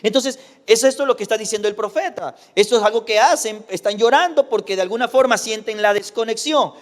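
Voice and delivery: ordinary talk, nothing marked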